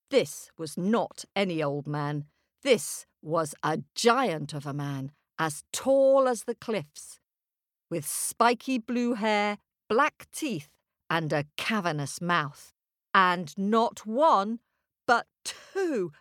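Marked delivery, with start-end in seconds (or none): none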